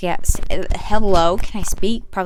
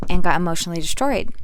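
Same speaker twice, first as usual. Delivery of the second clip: normal talking voice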